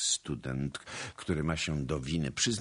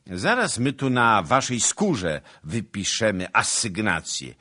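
loud, raised threat; threatening; 5 semitones higher, 8 dB louder than usual. A narrator's reading. Soldiers threatening townsmen with a beating, loud menace. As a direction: throw it out as a swaggering threat.

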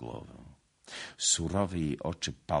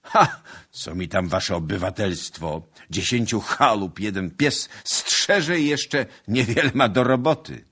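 loud scoff, rising higher; scornful; 5 semitones higher, 9 dB louder than usual. A narrator's reading. Suitor dismissing the local gentry, loud scorn. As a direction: burst out with contempt, building in volume.

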